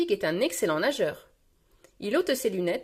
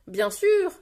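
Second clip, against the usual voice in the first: high, louder voice leaping upward; eager agreement; 11 semitones higher, 5 dB louder than usual. Acted. Rebecca confirms to Peppa, eager and high. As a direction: chirp it as a keen little character.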